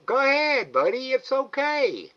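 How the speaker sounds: high, loud sing-song coaxing of an animal; coaxing pet talk. Real, not acted. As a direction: coax the shy raccoon to come and eat. Coaxing the raccoon again.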